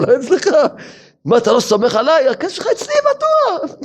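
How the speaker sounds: high, hammed-up wheedling reassurance; mocking the debtor. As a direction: mimic the crook soothing his victim. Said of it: "הוא אומר". (חיקוי לועג ומתחנף של הנוכל)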